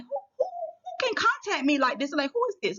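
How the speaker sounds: high, baffled stammering question; high astonished asking. Retold or imitated.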